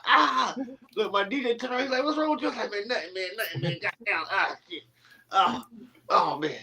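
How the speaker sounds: high, strained retelling of the burn; hammed-up pain after burning his hand; mock pained. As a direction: act out the hurt, wincing.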